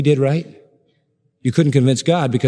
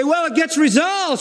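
high-pitched mimicked excuse; self-justifying objectors; 11 semitones higher, slower than usual. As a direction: imitate people protesting in their defense. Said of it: "So we say".

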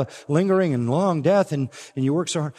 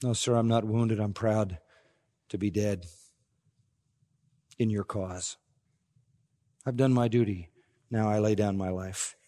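much softer, fading last words; dying soldier; 6 semitones lower, 7 dB quieter than usual. Acted. Dying soldier to his emperor, soft and fading. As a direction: let the voice sink as life ebbs away.

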